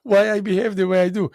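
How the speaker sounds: loud, high, laughing comeback; loud cheeky reply to the master; laughing retort. Retold or imitated. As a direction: fire it back at him, half laughing. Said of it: "I said".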